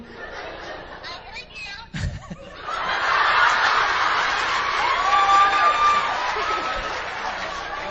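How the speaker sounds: long high burst of laughter; loud embarrassed laughter. Real, not acted. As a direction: laugh it off, caught out. Caught on speakerphone, audience laughing.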